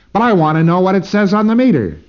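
insistent demand. Retold or imitated. Preclear insisting on meter reading.

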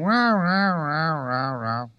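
high wordless row-row-row, falling off; imitated noisy yammering. Person being run on a drill, mock yammering. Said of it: "this person is going".

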